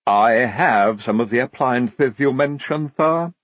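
stiff, slow reply from an Igor. A narrator's reading. Igor answering the lieutenant about scissors, stiffly. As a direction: answer the officer with wounded formality. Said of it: "said Igorina stiffly".

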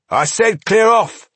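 high, loud snapping threat; snapping dwarf leader. Acted. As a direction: snap it as the angry dwarf. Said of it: "snapped their leader".

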